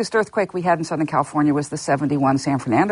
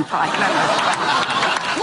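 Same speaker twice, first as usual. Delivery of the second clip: slow, chuckling through the words; laughing; much slower than usual. Real, not acted. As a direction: laugh it out at the grim irony. Grim joke, laughing.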